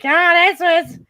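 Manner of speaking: acted tipsy outburst. Acting out self after a brownie.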